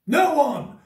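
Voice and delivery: sudden high, loud mock outburst; epic monologue outburst. Answering his own question to viewers, epic monologue outburst. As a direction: fire it out high and loud, hammed-up. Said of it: "go into epic monologue beast mode".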